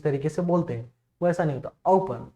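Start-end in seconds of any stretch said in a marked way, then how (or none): 1.9-2.3 ओपन said slowly with an easy अउ glide. Shown as a demonstration. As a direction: stretch the opening glide in ओपन. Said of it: "अ और उ बहुत easily ये बोले जाते हैं".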